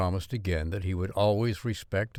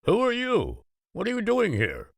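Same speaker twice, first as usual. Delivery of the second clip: gruff farmer voice; 13 semitones higher than usual. A narrator's reading. Gruff farmer challenges strangers.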